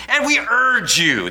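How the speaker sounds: drawn-out, forceful command; military command. Acted. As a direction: urge them like an officer giving orders. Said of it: "command military tone".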